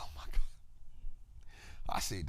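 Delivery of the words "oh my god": put-on a hushed gasp at what the old man said; shocked whisper. Reacting to Buffett calling people idiots, in a shocked whisper. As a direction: breathe it out, unvoiced and stunned.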